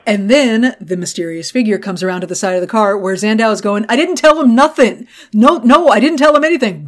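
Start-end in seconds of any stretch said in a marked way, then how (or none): 3.9-6.9 high, loud mock panic; panicked pleading. Prisoner facing the gunman in the car, panicked pleading. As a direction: plead frantically as the cornered prisoner.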